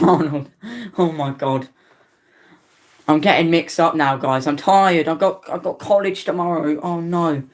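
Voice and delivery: normal talking voice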